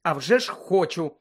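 ordinary delivery, nothing marked

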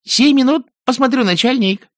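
very high, loud squeaky minion; distant voice. A narrator's reading. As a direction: squeak it eagerly down the tube. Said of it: "Голос затих вдали". (далёкий, очень высокий голос подчинённого)

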